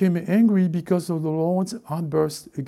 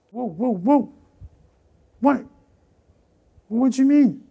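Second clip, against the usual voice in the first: mock alarm; 7 semitones higher, 4 dB louder than usual. Mock alarm at persecution talk.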